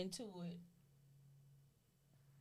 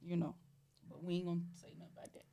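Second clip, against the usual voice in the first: usual speaking voice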